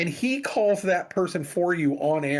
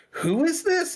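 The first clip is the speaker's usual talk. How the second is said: much higher, puzzled question; confused who is this; 13 semitones higher than usual. Retold or imitated. A confused caller asks John who is this, high and baffled. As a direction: voice jumps up high in bewilderment. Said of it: "the person is confused".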